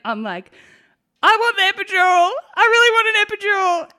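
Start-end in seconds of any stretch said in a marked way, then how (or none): 1.2-3.9 high, loud re-enacted plea; acted demand. Birth mother re-enacting her demand for pain relief, high loud plea. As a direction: wail it as a mock labour demand.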